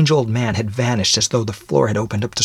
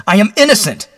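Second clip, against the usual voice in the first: loud, high cry of innocence; explosive high-pitched protest; bursting forth; 13 semitones higher, 7 dB louder than usual. Acted. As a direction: shout it out desperately. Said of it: "Then he burst forth".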